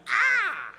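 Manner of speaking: scream of pain. Retold or imitated. Storyteller acting out being stabbed in the groin, pained scream. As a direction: yell as if suddenly stabbed.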